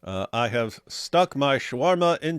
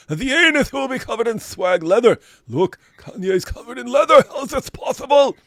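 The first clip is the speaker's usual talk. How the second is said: high, loud ranting preacher parody; mock zealot rant; 11 semitones higher, 6 dB louder than usual. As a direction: rant at the heretics, hammed up. Mock-reads a zealot's comment.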